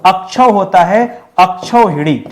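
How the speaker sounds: अक्षौ said slowly, the औ drawn out; slow down on अक्षौ and lean on the औ; slow spelled-out correction. Shown as a demonstration. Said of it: "औ की मात्रा लगाएगा". (अक्षौ को धीरे-धीरे, औ पर ज़ोर देकर)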